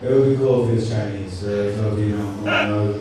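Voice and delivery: ordinary delivery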